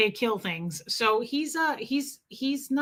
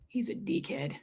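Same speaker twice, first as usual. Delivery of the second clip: whisper; 3 semitones lower, 10 dB quieter than usual. Real, not acted. Aside about her cat to chat.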